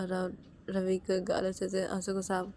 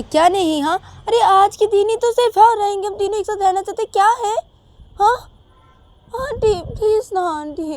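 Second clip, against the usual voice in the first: high, loud insistent plea; loud pleading; 13 semitones higher, 18 dB louder than usual. Acted. Shreya loudly begging auntie to let them stay. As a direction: beg loudly, pitched high.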